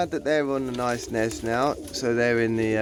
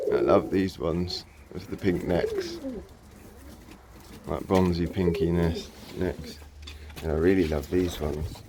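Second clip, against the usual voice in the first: ordinary delivery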